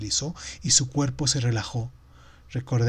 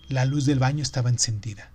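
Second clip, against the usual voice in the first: usual speaking voice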